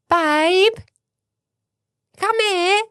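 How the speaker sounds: high imitation in a Cockney accent. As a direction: call out in a mock Cockney accent. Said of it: "that was actually Cockney".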